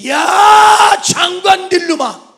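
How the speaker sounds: very high, very loud drawn-out holler; mock angry yell. Preacher acting out a curse that blesses, mock furious yell. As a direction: bellow it like a scolding, stretching the first word.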